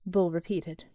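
plain reading voice

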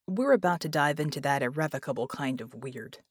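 ordinary delivery

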